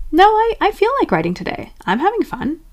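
very high, stammered fake cheer; forced denial. Squashing down dread of writing, fake-cheery denial. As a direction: insist unconvincingly in a squeaky-high voice. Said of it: "Squashing it down would be".

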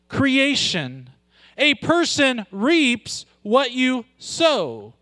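slow, weighty preaching voice; slow emphatic preaching. Acted. Pastor drives the verse home to the church. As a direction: lean on each word slowly.

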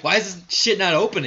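loud, high, exasperated at the stuck door; half-hammed annoyed shout; mock frustrated yell. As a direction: yell it like you're yanking a locked door. Frustrated at locked door, retold.

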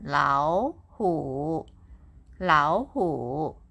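"Lao" rising, "hu" dipping low; third tone as second. Shown as a demonstration. Teacher explaining paired third tones to learners, first one read rising. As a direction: lift "Lao" upward, then dip on "hu". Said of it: "read the first third tone as second tone".

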